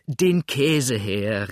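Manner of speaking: slow demanding call. A narrator's reading. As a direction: call for the cheese, dragging each word. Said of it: "rief er".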